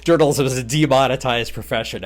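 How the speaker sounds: amused, laughing loudly while repeating the phrase. Real, not acted. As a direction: talk through a burst of laughter. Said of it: "Sorry, I'm laughing".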